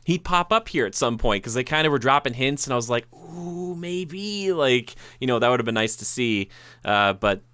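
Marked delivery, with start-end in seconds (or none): 3.1-4.5 zacht, hoog en ademloos hoopvol; a quiet, hopeful high gasp of maybe; hopeful ooh. Retold or imitated. Hoping actor shows up.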